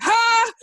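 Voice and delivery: a sharp, high, loud "Ha!"; sudden laugh. Retold or imitated. Reading something and laughing out of place, blurted. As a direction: burst out with one blurted laugh.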